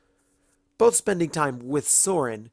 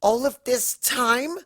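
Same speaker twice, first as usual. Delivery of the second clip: much higher, louder, slow disbelieving screech; 13 semitones higher, 5 dB louder, slower than usual. Acted. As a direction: shriek it in disbelief. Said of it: "Twilight screeched".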